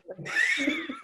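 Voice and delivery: high delighted laugh breaking out; burst of laughter. Real, not acted. Recalling the drag name bit, a high delighted laugh.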